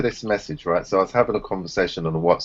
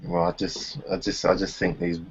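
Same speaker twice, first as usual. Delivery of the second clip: normal talking voice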